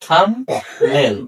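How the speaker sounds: থাম্বনেল slowly in two parts, "tham... nail"; drawn-out teaching model of থাম্বনেল without B; silent B. Shown as a demonstration. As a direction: say থাম্বনেল with no B, pausing after the M. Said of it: "বি এর উচ্চারণ".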